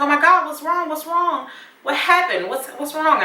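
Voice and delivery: a friend on her knees, panicking over you; alarmed roommate. Retold or imitated. Roommate finds her crying.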